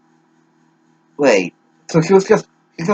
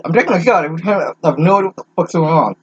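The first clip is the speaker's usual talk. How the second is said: fast exasperated; 3 semitones higher, much faster than usual. Real, not acted.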